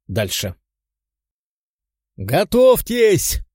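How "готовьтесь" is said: high, loud, stretched battle cry; shouting. Acted. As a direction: yell the warning to the squad. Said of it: "прокричал Тео".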